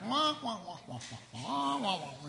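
imitated muffled wah-wah adult talk; Charlie Brown parents' wah-wah. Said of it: "Charlie Brown's pants".

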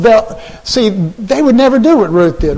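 usual speaking voice

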